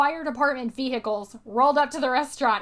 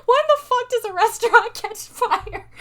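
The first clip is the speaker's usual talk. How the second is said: high, loud disbelief breaking into laughter; laughing, exclaimed bafflement; retold incredulity; 10 semitones higher, 7 dB louder than usual. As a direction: ask it appalled and cracking up. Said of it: "we were just like".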